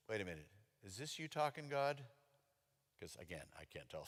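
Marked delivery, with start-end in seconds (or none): 0.1-2.0 suspicious hush. Retold or imitated. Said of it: "creepy suspicion".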